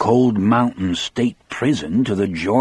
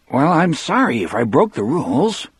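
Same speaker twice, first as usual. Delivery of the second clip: a hint of whiny; 6 semitones higher than usual. A narrator's reading. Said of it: "It came out sounding whiny, a little shrill".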